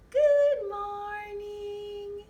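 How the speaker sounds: high, very slow greeting; high Bella voice. Pretend. Girl puppet greeting high and slow. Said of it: "Bella sounds high".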